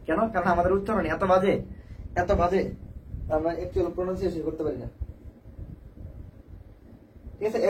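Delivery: ordinary delivery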